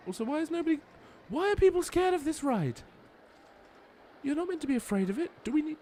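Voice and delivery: very high-pitched, incredulous complaint; high, indignant questioning of timid guests; exasperated disbelief. Real, not acted. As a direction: squeal with exasperated bafflement. Baffled that guests fear the ride.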